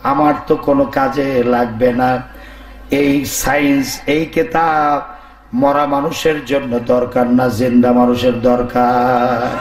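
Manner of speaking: low, monotone voice of the dead; the dead man's low reply; corpse speaking. Acted. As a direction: drop far down in pitch as a talking corpse. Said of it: "এখন লাশ বলে".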